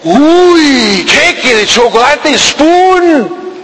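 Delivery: high, slow, thrilled gasp over cake; delighted neighbour. Retold or imitated. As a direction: drool over the chocolate cake. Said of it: "los vecinos dicen".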